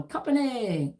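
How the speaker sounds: a lightly voiced drill call, pitch up then falling; military command call. Shown as a demonstration. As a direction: call "Company" like a sergeant before formation. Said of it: "when I just did that".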